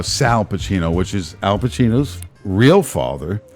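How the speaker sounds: normal talking voice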